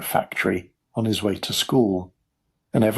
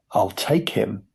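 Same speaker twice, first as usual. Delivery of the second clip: usual speaking voice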